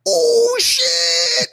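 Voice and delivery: high, loud startled "Oh shit!"; shocked yelp. Retold or imitated. A classmate yelps in shock as the bully gets pinned, very high and loud. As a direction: shriek it out, way up high, like a kid who can't believe what he saw.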